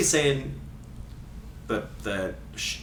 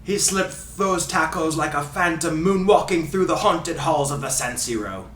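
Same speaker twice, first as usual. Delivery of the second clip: put-on Ray Hudson Scottish accent. Host reading a free kick quote, in fake Scottish accent. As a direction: fake Scottish commentator, theatrical. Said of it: "doing his accent".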